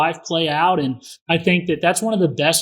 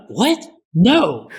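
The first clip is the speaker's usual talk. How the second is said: a high, shocked "What?" then a louder "No."; startled daughter; 4 semitones higher, 4 dB louder than usual. Retold or imitated. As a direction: daughter taken aback by her dad's overreaction. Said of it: "And she's like".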